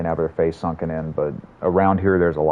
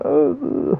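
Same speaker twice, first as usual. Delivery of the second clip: high whiny uh; whiny baby. Retold or imitated. Streamer mocks himself as a whiny baby wanting a console, high whine. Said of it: "a whiny little baby going".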